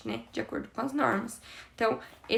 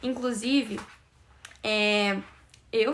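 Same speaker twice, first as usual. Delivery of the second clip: ordinary talk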